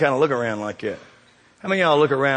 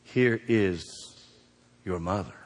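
tender, fading; 6 semitones lower, 8 dB quieter, slower than usual. Retold or imitated. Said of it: "what does he say".

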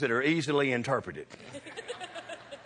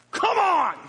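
high loud yell kicking the horse; desperate high holler; 14 semitones higher, 7 dB louder than usual. Retold or imitated. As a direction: scream it out to get the horse moving. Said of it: "I was like".

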